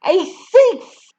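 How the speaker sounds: high, loud shrieked accusation; screamed. A narrator's reading. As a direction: scream the charge. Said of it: "the Trunchbull screamed".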